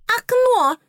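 very high, loud pixie squeak; squeaky pixie. Acted. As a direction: squeak it like a tiny fairy. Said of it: "пропищала зубная фея".

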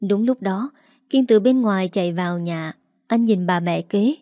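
ordinary delivery

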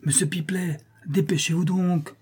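high, urgent calling voice; pressing tone. A narrator's reading. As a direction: call from afar, pressing him to hurry. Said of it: "répéta la voix d'un ton pressant".

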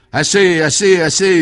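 very loud, high-pitched cries; desperate scream. A narrator's reading. Tormented gangster begs the commissioner to stop, screaming desperately. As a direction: yell for it to stop. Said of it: "hurlait Ritou".

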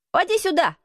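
put-on high-pitched boy calling out; boy's high, imperious call; shouted order. A narrator's reading. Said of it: "крикнул он Дикону".

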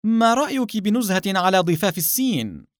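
openly chatty, high voice; audible voice. A narrator's reading. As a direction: pitch it up so an eavesdropper hears. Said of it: "بصوت مسموع".

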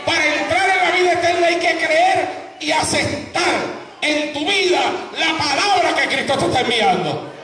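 high-pitched, fervent pulpit proclamation; full-force preaching. Acted. Preacher urges congregation to accept Christ, full-force preaching. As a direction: cry the word out with long, stretched key phrases.

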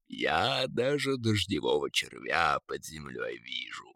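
low, soft eagle boast; boastful eagle. A narrator's reading. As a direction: brag down in a deep bird voice. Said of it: "похвастался орёл". (низкий хвастливый голос орла)

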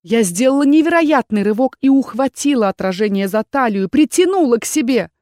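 ordinary delivery

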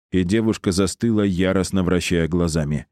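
ordinary delivery, nothing marked

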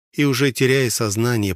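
usual speaking voice

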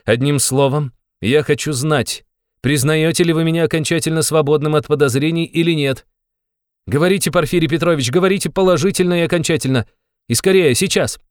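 high, urgent pressing, building in force; insistent demand. A narrator's reading. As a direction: demand an answer at once. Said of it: "настойчиво и громко сказал он".

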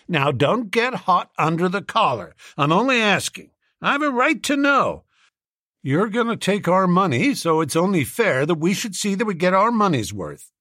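high-pitched, insistent American businessman; sceptical businessman. A narrator's reading.

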